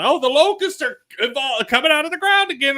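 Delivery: hammed-up panic about locusts; mock panic. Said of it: "they lose their shit about".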